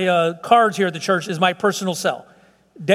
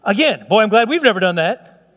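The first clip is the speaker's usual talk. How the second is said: ordinary delivery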